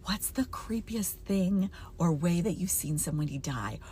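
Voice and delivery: eerie whispered question. Acted. Reading a creepy question to viewers in a whisper. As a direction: whisper it like a ghost story opener.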